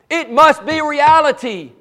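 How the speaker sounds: usual speaking voice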